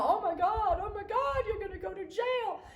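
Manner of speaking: high, loud, alarmed scolding shout; frantic grandma. Retold or imitated. Grandmother fearing the father will go to jail, high alarmed shout. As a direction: sound the alarm like a frightened old lady. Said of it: "my grandmother is going".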